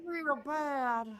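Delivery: soft high Jennifer Coolidge voice; Jennifer Coolidge impression. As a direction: croon it like a lazy Hollywood diva. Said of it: "your Jennifer Coolidge is so good".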